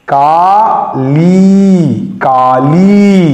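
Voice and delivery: Kali said very slowly, long आ and ई; teacher modelling the word slowly; slow drawn-out reading. Shown as a demonstration. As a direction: stretch Kali out syllable by syllable. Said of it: "पढ़ा है का".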